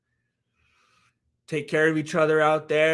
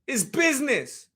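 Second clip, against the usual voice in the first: higher-pitched, badly faked Conor accent; attempted Conor accent; 7 semitones higher than usual. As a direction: have another go at a Conor accent. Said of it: "I can't do a Connor accent".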